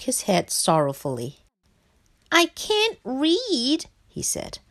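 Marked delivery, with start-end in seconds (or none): 2.3-3.9 high, slow plaintive frog; sorrowful. A narrator's reading. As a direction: draw it out sadly in a high frog voice. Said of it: "he sobbed".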